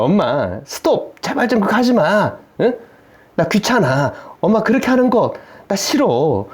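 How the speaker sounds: mock irritated child. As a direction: act out a fed-up kid protesting to mom. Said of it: "짜증입니다".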